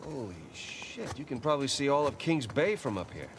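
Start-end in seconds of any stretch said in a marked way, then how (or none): 0.0-1.4 hushed awe. Acted. Awed at the view from above.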